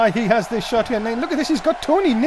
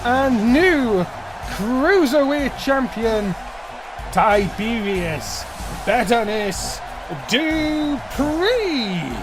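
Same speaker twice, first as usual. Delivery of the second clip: slow drawn-out announcer voice; ring announcer; much slower than usual. Acted. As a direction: announce the champion slowly. Ring announcer style introduction.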